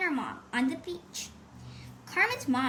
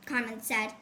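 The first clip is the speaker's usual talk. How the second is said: ordinary delivery, nothing marked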